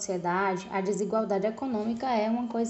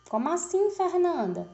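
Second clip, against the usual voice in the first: puzzled student; 7 semitones higher than usual. Pretend. Voices a student's question to herself.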